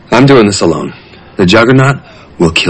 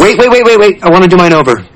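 urgent; 9 semitones higher, 4 dB louder than usual. Real, not acted. Urgent interruption.

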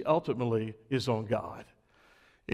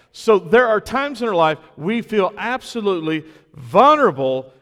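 louder, higher, rising preacher's cry; preaching at full force; 5 semitones higher, 7 dB louder than usual. Acted. Pastor lifting the congregation with good news, loud full-force preaching. Preaching climax, nobody names it.

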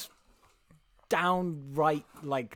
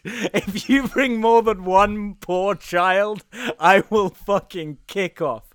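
louder, slow comic warning; mock threat; 3 semitones higher, 7 dB louder, much slower than usual. Santa warning the conductor about poor children, slow mock threat. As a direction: lay down the law slowly, half-joking.

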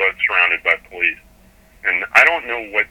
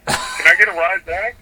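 much higher, breezy mock-casual ask; casual stranger asking a favour; 9 semitones higher, slower than usual. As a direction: toss off the request as if nothing happened.

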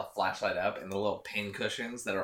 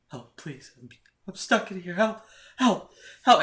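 rising, distant mock pleading; lightly voiced creepy plea; ghostly cries for help; 9 semitones higher, 8 dB louder than usual. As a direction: call out as a trapped ghost. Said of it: "this voice of a man go".

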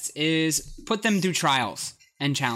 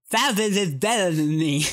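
garbled speech, tongue held out; holding tongue with fingers; 2 semitones higher than usual. Real, not acted. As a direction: say it while gripping your tongue. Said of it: "grab your tongue and hold it out and say this word".

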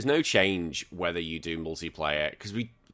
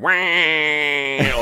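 mock Wario cry; 6 semitones higher, 7 dB louder than usual. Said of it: "this Wario going".

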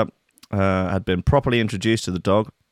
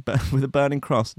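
ordinary talk, nothing marked

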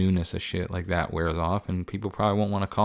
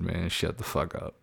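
quoting caller; 8 dB quieter than usual. Retold or imitated. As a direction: replay the caller's line quietly. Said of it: "That motherfucker said".

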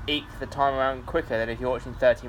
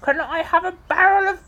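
high, loud, exaggerated English accent; more English accent; 7 dB louder than usual. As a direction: push the English caricature right over the top. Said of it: "but more English".

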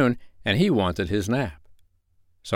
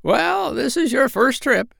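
very high, squawky parrot voice; squawking parrot voice; slower than usual. Acted. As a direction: squawk it out like a bossy old parrot. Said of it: "said Polynesia".

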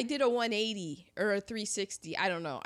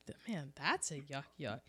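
muttered groan; 9 semitones lower, 10 dB quieter than usual. Retold or imitated. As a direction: drag out the words as if rolling your eyes. Groaning at corny movie jokes.

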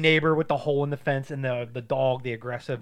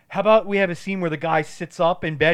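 pitching writer; 3 semitones higher, 7 dB louder than usual. Retold or imitated. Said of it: "and he's like".

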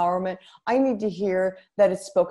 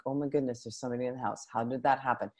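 scared hush; 4 semitones lower, 8 dB quieter, much faster than usual. Retold or imitated. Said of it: "we hear this mumbling".

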